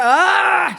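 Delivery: loud high cry of emotion; overwhelmed cry. Retold or imitated. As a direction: let out a sudden high Ah. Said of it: "And then I go".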